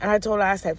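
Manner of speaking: ordinary talk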